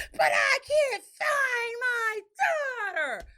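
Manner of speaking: high drawn-out mock wail; screaming. Wife mimicking husband still screaming in the truck. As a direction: wail it slowly in overblown panic. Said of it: "he's sitting there still screaming".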